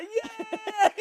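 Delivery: high drawn-out mock yelp; imitated pained shout. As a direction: cry out as if lowering onto a sore hip. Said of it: "I just shouted out".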